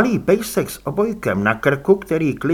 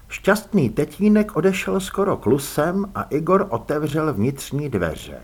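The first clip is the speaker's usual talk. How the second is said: ordinary delivery, nothing marked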